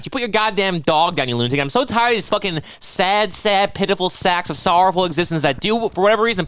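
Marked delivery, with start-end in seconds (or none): none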